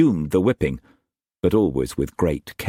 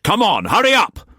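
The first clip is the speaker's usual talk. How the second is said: higher, louder raging master's voice; furious master; 5 dB louder than usual. A narrator's reading. Struan raging at Mei Mei before whipping her, furious shout. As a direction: bark orders like an enraged lord.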